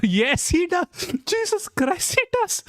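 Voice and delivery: can barely get the words out for laughing. Real, not acted. Streamer to chat about the resigning official, helpless high laughter. Cracking up at the clip.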